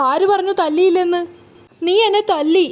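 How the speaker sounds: high, insistent accusation. A narrator's reading. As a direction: pitch it up and accuse. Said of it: "ശബ്ദം ഉയർത്തിയിട്ടാണ് സംസാരിക്കുന്നത്".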